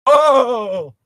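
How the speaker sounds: high, slow comedian bit with a laugh; Jerry Seinfeld. Pretend. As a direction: do a standup observational line. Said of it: "what voice did y'all give him".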